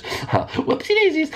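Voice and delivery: squeaky high, slow whoopsie; whoopsie daisies. Real, not acted. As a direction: stretch it up high and slow, laughing at yourself. Laughing at own mistake.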